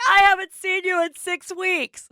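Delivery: high, loud squealing welcome; excited mom. Retold or imitated. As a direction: mom yelling hello with joy.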